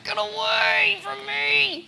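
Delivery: high, drawn-out mock cry; harassed girl. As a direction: wail it to fend off pursuers. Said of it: "and she's like".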